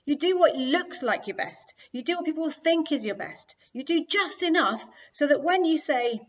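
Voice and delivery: loud, high, scolding accusation; furious sister laying into him; angry retort. A narrator's reading. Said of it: "getting red in the face with anger".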